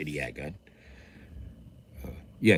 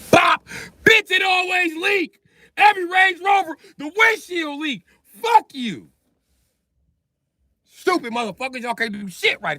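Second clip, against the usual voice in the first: mock furious shout. Boss raging at windshield designer Stan, mock furious. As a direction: scream about the leaking windshield. Acted rant about leaking windshield.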